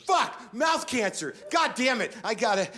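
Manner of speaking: mock dread as he dozes off; panicked. Falling into a panic nap, mock panicked cursing. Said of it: "a panic nap that starts with".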